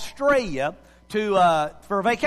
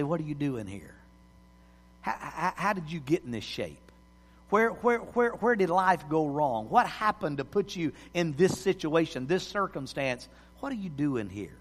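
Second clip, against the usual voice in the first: quiet probing voice; 6 semitones lower, 8 dB quieter than usual. Retold or imitated. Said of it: "have God speak to you and say".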